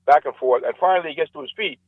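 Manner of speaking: ordinary delivery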